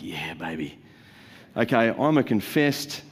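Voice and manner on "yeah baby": breathy whispered delight; savoring whisper; gleeful whisper. Pretend. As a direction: whisper it like a guilty pleasure. Legalists relishing rules, whispered.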